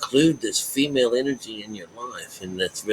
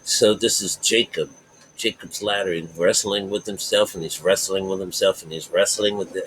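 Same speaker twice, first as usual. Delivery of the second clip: normal talking voice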